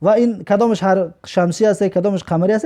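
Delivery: normal talking voice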